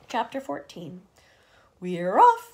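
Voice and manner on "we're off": loud excited shout; joyful loud cry. A narrator's reading. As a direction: call it out for everyone. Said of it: "someone was shouting".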